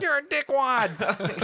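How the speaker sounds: high, drawn-out mimicked taunt; mock insult. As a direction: imitate an outraged coworker, stretched and high. Mimicking coworker's childish insult.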